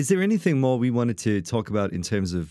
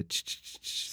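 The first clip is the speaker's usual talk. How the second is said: soft unvoiced clicking; roach clicking noise; 14 dB quieter, slower than usual. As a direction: mimic roaches skittering. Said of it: "and you just hear".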